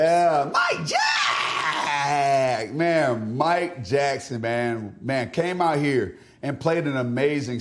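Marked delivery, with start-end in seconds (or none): 0.0-2.7 excited shout. Acted. Hyping a teammate's name.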